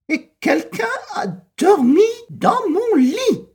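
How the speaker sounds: very high, loud shrieking cry; mama bear shrieking in outrage; roaring Mama Bear. A narrator's reading. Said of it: "rugit Maman Ours". (Maman Ours qui hurle, très aigu et fort)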